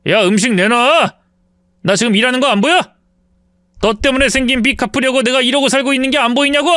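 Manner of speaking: indignant, accusing flare-up; sudden angry outburst. Acted. Ex-husband blaming his ex-wife for his debts, angry. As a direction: flare up and blame her. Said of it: "버럭 화를 내더군요".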